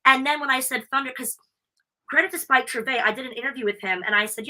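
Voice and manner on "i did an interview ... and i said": ordinary delivery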